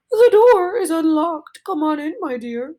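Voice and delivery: a wolf straining to pass as grandma; wolf faking old lady. Acted. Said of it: "trying very much to sound like an old lady".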